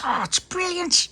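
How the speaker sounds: very high, slow excited gush; delighted. Acted. Tony delighted by the bin lid idea, high excited voice.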